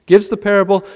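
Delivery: ordinary talk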